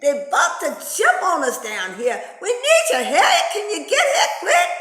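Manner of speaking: high, loud, panicky official; Mister Enforcer voice. Retold or imitated. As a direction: squeal it out like a nervous boss. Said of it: "Mister Enforcer say".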